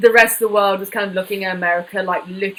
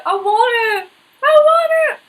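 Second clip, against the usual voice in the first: whiny beggar; 13 semitones higher, 5 dB louder than usual. Retold or imitated. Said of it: "and you're like".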